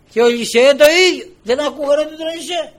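loud, high exclamation; impassioned preaching. Real, not acted. Preacher laments nobody heard Elisha, impassioned. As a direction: hurl it out from the pulpit.

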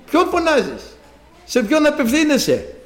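high, insistent questioning; voice of the Lord. Retold or imitated. The Lord asks whom he turns to, retold high rebuke. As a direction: press the challenge, pitched well up. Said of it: "Ξανά ο Κύριος".

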